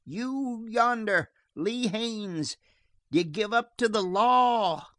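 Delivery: high, slow hail to a far-off man; calling across distance. A narrator's reading. Sheriff calling to Lee Haines in the hills, high slow hail. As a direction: stretch the words to carry far.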